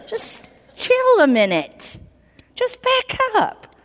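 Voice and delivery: high, slow, half-laughing plea to calm down; hammed-up Moses pleading, chuckling; frantic Moses. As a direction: hold the boss back with a high, stretched voice. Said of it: "can you imagine yourself calming God down".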